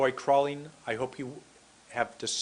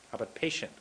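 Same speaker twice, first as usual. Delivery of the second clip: normal talking voice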